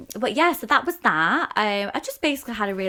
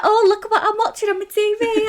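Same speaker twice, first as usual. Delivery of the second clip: high mock friend voice, much louder; imitating Nora; 13 semitones higher, 9 dB louder than usual. Telling her friend about Nora's weekly TV photos, high and loud. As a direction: squeal it like an excited fan sending pics. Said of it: "She's like".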